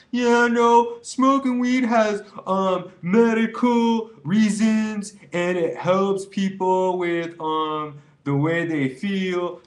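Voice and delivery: high, loud, slow mocking weed defender; hammed-up stoner excuse voice; mock skeptic. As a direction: parody the pro weed viewer. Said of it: "skeptical person out there watching this video right now is like".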